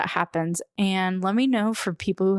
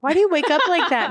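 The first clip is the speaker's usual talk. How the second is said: mock kid question; 10 semitones higher, 4 dB louder, faster than usual. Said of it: "I'd be like".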